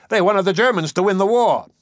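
high, loud accusing shout. A narrator's reading. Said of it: "he shouted".

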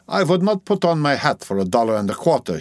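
put-on scornful Dutch-accented woman; Dutch midwife, scornful.

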